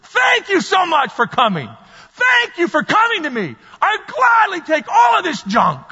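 high, joyful holler with stretched words; exuberant shouted thanks. Acted. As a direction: cry out thanks at the top of your range. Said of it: "Jesus is gonna go".

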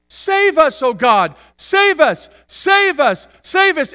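high, loud repeated cries; shouting crowd. Acted. Preacher acts the crowd begging God, shouting. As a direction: shout the plea over and over. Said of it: "shouting at the top of their lungs".

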